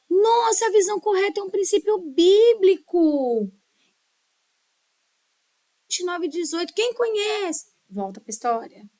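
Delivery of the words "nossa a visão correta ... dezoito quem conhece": high, loud, exclaiming storyteller; animated storytelling intonation. Shown as a demonstration. Teacher demonstrates engaging children with a Bible principle, animated intonation. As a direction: burst out as if amazed, pitched well up. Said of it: "mas sempre com a entonação".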